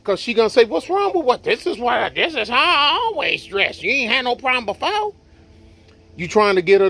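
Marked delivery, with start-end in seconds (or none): 0.6-5.1 put-on girlfriend voice. Girlfriend quoted, higher.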